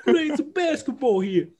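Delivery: high, hammed-up New York accent. Joking Draymond plays ball in New York, hammed-up New York accent. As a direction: play a cartoon New Yorker. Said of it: "Develop a New York accent".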